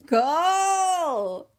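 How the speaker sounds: long, high, loud cry; exclaimed name. Real, not acted. Echoing co-host about who would never, loud cry. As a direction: draw the name out loudly, pitched high. Cries out the absent character's name.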